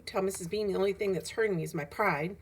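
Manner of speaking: plain reading voice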